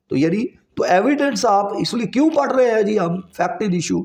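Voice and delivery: ordinary talk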